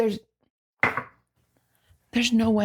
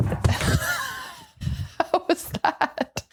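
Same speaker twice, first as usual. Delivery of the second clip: out of breath; 12 semitones higher, much slower than usual. Real, not acted. Right after the sprint.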